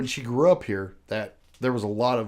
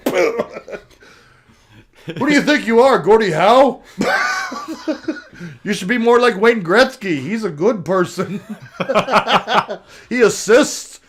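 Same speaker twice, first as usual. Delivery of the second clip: loud, higher hammed-up scolding with a Canadian "eh"; mock Canadian cop yelling; 7 semitones higher, 9 dB louder than usual. Cop yells at hockey-stick vandal, loud mock Canadian scolding. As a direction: bellow at him like a riled-up Mountie.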